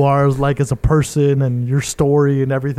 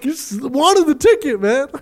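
mocking high voice; 11 semitones higher, slower than usual. Mocking friend's Pikachu face.

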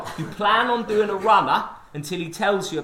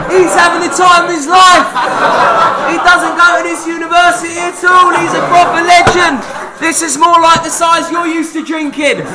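shouted play-by-play; 9 semitones higher, 12 dB louder than usual. Real, not acted.

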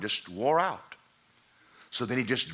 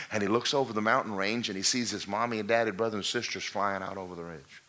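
normal talking voice

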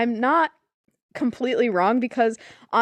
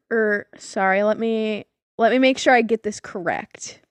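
ordinary delivery

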